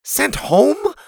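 high, disbelieving echo; stunned boy's rising question; shocked question. A narrator's reading. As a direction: repeat it in alarm, pitch leaping up. Boy shocked at threat of expulsion.

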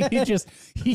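high laughing voice. Real, not acted. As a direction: squeak through the laughter. Laughing hard at clip.

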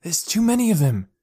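urgent reasoning. A narrator's reading. Boy argues with frightened girl, urgent reasoning. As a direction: reason with her to stay hidden. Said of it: "he reasoned".

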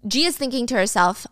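ordinary talk, nothing marked

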